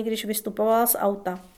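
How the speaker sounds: usual speaking voice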